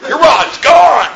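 high, slow mocking shout; taunting shout, high and drawn out. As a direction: gleefully yell it back at them. Mocking sorcerers, shouting back.

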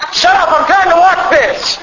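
high, drawn-out holler from the couch; hammed-up irritated shout; yelling parent. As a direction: yell like an annoyed TV watcher.

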